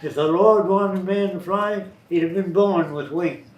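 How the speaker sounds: imitating a stubborn country farmer; loud country farmer voice; farmer accent. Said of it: "That's the way they talk".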